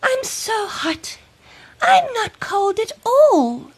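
put-on high bright child voice, eager; little girl voice. Child character speaking.

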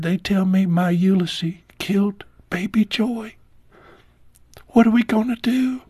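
whispered at first, then a high pleading cry; a hint of a tearful wife begging for help; retold crying. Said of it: "she was crying".

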